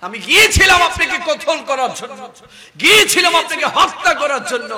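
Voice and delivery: high, loud outcry; impassioned confession. Retold or imitated. Preacher as Umar confessing to the Prophet, impassioned. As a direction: confess it at full preaching force.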